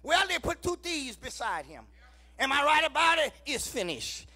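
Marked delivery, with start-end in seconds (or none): none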